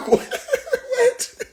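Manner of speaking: very high squeaky disbelieving what; squealed what. Acted. Joking about rich rappers crying, a squeaky disbelieving what. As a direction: squeal it in shock.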